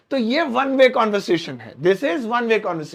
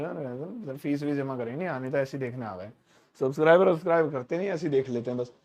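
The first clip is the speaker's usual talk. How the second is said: much softer, lower grumbling under the breath; muttering; 6 semitones lower, 5 dB quieter than usual. Shown as a demonstration. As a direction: mutter it to yourself so nobody can hear. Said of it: "जैसे मैं आपसे बोल रहा हूँ". (मुँह ही मुँह में बड़बड़ाना)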